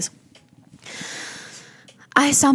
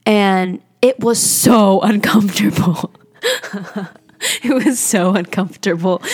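laughing through the words; an awkward, embarrassed laugh. Real, not acted. Telling a podcast friend about crying with her aunt, laughing awkwardly. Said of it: "I'm laughing because it's like".

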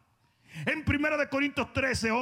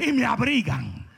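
usual speaking voice

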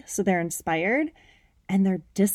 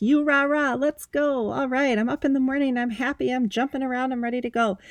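higher, mock rah-rah pep as if bouncing out of bed; hyped-up morning cheer; 5 semitones higher than usual. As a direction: play the fake always-pumped version of yourself. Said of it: "I'm not always like".

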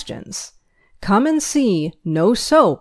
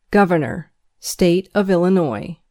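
normal talking voice

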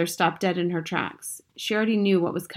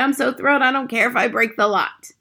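high, loud, giddy outburst; thrilled shout; 6 semitones higher, 6 dB louder than usual. A narrator's reading. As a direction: shout with reckless glee. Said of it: "she shouted".

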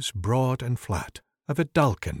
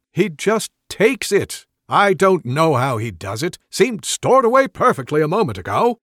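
high, loud, slowed fussy wizard; exasperated complaint; 6 semitones higher, 7 dB louder than usual. Acted. Wizard slumped on bench complaining about the thief, exasperated. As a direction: whine it in disbelief.

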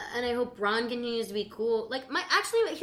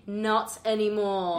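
slow, deliberate British accent; proud mock-British declaration; slower than usual. Shown as a demonstration. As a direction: show off a put-on British accent. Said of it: "renaissance of my British accents".

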